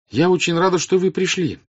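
high, loud princess addressing a crowd; earnest princess. Acted.

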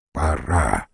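low, dark menacing voice; a cold, low voice from the dark; sinister stranger. A narrator's reading. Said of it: "отвечал незнакомец".

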